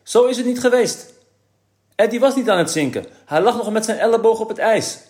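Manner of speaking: put-on a boy insisting on the truth; indignant protest. A narrator's reading. Kees correcting his father's reading, high and loud.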